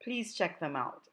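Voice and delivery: plain reading voice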